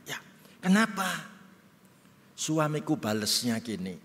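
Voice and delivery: usual speaking voice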